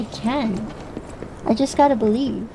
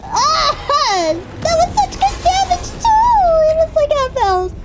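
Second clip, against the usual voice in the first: frustrated yelling. Real, not acted. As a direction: yell at the game after losing. Boss fight loss, frustrated yelling.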